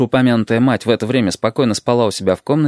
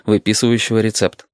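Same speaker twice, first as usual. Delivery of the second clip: ordinary delivery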